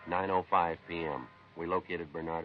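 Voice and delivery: steady, matter-of-fact police report voice; case narration. Acted. Detective telling listeners the case, matter-of-fact narration. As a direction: lay out the facts like a logbook entry.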